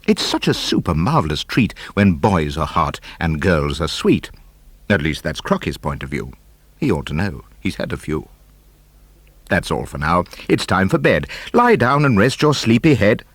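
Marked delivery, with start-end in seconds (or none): none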